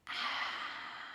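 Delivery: soft, long audible exhale; slow sigh of release; breathing-out sigh. Real, not acted. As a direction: let the breath out in a quiet sigh. Long exhale between breathing cues.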